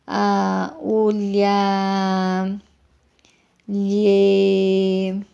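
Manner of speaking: "lha, lhe, lhe, lho, lho", each long-held and separate; syllable family recited. Shown as a demonstration. Teacher showing syllables similar to lho, long-drawn recitation. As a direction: hold each vowel on a level note, pausing between. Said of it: "que é parecido com".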